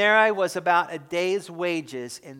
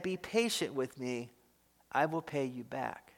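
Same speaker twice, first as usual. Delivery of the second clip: much softer, lower, humble plea; begging; 5 semitones lower, 11 dB quieter than usual. Acted. Fellow servant on his knees begging for patience, hushed plea. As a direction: plead quietly on your knees. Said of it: "begged him".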